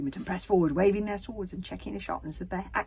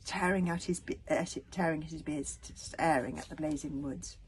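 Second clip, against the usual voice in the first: plain reading voice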